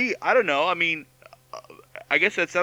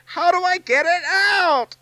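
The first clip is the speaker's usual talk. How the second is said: much higher, loud pleading squeak; desperate squeal; 5 dB louder than usual. Acted. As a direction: squeal the question in despair. Desperate plea, jump in pitch.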